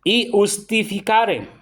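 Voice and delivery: "iustificare" very slowly, opening on a separate i sound. Shown as a demonstration. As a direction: spell out "iustificare" by syllables, i-us-ti-fi-ca-re. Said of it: "se cambia la".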